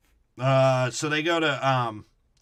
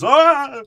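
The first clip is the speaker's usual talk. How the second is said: high, loud hammed-up old man cry; old man noise; 13 dB louder than usual. Performing a requested old man noise, loud hammed-up yelp. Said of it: "make some old man Alzheimer's noise".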